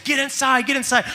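high, urgent mock mom call; panicked mom. Moms running out as the storm hits, high urgent calling. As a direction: imitate a worried mother calling kids in. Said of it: "I see moms running out, no joke, saying".

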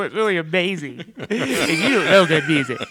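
high, loud laughing protest; laughing indignation. Retold or imitated. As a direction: protest your song through laughter. Indignant songwriter defending his song.

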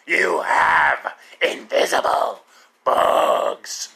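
slow, rasping wrestling-boss growl; hammed-up hoarse yell, dragging every word; Vince McMahon. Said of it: "It was like Vince McMahon".

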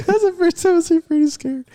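very high through lingering laughter; laughing remark. Real, not acted. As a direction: still half laughing as you say it. Laughing after the scare.

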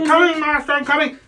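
high, eager gnome servant; gnomey voice. Acted. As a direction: squeak back obediently as a hurried little gnome. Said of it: "your little gnomey voice".

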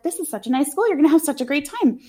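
imitating someone full of happiness; optimistic and happy. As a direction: gush with mock optimism. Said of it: "full of optimism and happiness".

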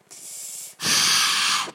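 long weary sigh; heavy sigh. Real, not acted. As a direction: sigh out loud, bored. Bored podcaster sighs.

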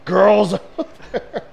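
high, mocking jeer that peaks on "girls"; hammed-up taunting, rising into a loud squeal; movie character's taunt. Acting out the movie's bathroom scene, mock taunting. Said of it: "He's like".

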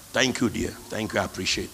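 soft, low, kind thank-you; gentle thanks. Retold or imitated. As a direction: soothe the person who apologised. Accepting apology gently.

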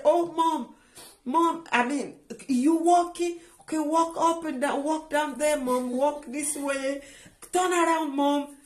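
high-pitched, imitating an excited child; retold excitement, pitched well up; excited young daughter. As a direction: urge mom on as her thrilled daughter. Youngest daughter thrilled mother walks, retold.

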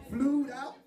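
"Flued out" pitched high as an incredulous question; mocking disbelief. Comedian mocking a woman's bad English, incredulous. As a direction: throw "Flued out" back, high and rising. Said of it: "Who's your English teacher?".

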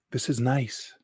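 hushed and intimate; quiet. Acted. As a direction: say it softly in her ear. Intimate hug, whispered line.

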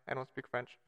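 ordinary talk

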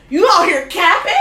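loud, high mock outrage; incredulous call-out. Confronting a guy who lives at home, loudly incredulous.

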